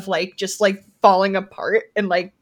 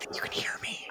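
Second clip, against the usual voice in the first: fast whisper into the mic; giggly prank whisper; 15 dB quieter, faster than usual. Pretend. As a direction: rush it out in a whisper.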